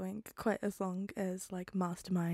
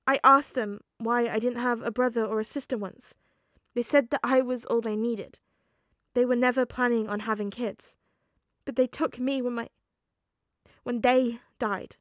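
louder, strained telling; upset explanation; 3 semitones higher, 8 dB louder than usual. Acted. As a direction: explain through distress.

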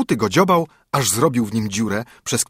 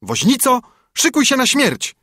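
much higher, louder threat; menacing call; 13 semitones higher, 4 dB louder than usual. A narrator's reading. Sparrow on the stove threatens the carter, shrieking high and loud. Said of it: "woła".

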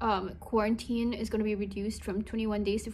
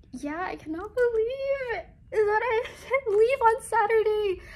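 happy tears; 11 semitones higher, 5 dB louder than usual. Real, not acted. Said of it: "I'm gonna start crying again".

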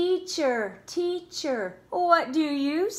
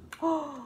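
gasp; 3 semitones higher, 6 dB quieter than usual. Acted. Gasp at goldfish.

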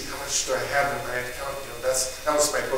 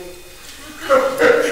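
breathy laugh; 12 semitones higher, 7 dB louder than usual. Real, not acted. Laughing at the thought.

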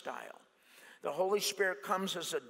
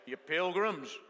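movie tough guy. Acted. Preacher doing famous voices for the congregation, cowboy impression. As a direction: draw out the words like a film cowboy.

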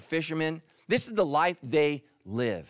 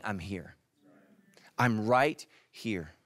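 tender reassurance; 7 semitones lower, slower than usual. Acted. Jesus comforting the sick.

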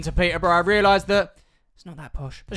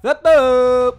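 mock beep; 5 semitones higher, 9 dB louder than usual. Mimicking the ticket scanner beep.

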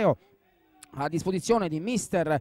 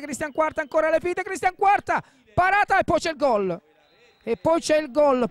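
rising, louder to a goal shout; excited goal call; 5 semitones higher, 4 dB louder than usual. Real, not acted. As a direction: climb with the attack, then shout. Goal call in live commentary.

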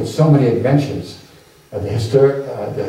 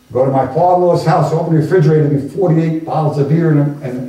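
usual speaking voice

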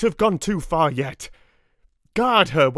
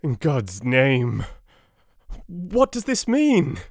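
slow, rising cry of dread; a husband's horrified outcry, climbing high; crying out in alarm; 5 semitones higher, slower than usual. A narrator's reading. Husband waking to find wife bleeding, crying out in horror. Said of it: "Harker cried out".

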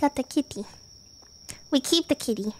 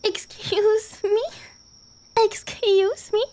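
slow, high excuse me; indignant disbelief; 6 semitones higher, much slower than usual. Real, not acted. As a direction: stretch it out in outrage.

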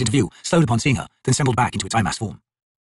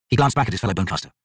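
usual speaking voice

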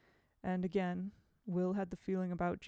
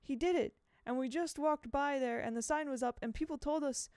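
put-on lightly trembling boy; 7 semitones higher, 4 dB louder than usual.

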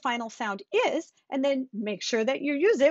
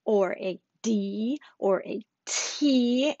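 slow, deliberate teaching; much slower than usual. Shown as a demonstration. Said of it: "a final consonant means".

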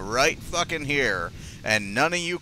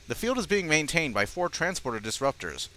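ordinary talk, nothing marked

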